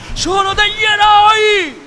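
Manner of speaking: high, loud, slow order stopping the fight; thundering command. Acted. Sandokan halting his men, thundering command. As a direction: thunder it over the whole deck. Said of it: "tuonò la voce della tigre".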